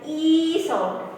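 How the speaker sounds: slow, stretched "E"; "E" held long as a vowel demo; long E sound. Shown as a demonstration. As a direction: draw "E" out into a long ee. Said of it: "I plus two dots".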